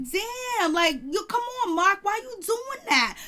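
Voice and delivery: high, drawn-out dismayed pleading; exasperated plea. Retold or imitated. Viewer asking Mark why he is doing that, exasperated plea. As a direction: plead high, as if begging him to stop.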